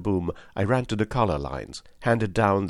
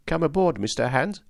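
ironic; 8 semitones higher than usual. A narrator's reading. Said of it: "I said ironically".

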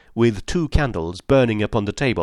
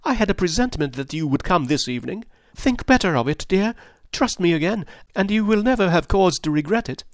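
higher, fast pleading woman's voice; lightly voiced wife begging him to trust her; 7 semitones higher than usual.

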